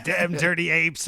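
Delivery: ordinary delivery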